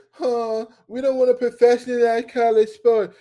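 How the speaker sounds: high-pitched mocking whine; mock protest. Mocking those who deny it's business.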